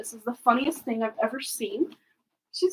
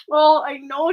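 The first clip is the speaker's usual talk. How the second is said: usual speaking voice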